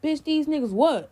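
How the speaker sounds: high retold challenge, landing on WHAT; imitated confrontational echo; challenging echo. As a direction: throw her words back as a question. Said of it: "he repeated exactly what she said".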